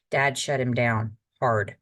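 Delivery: ordinary delivery, nothing marked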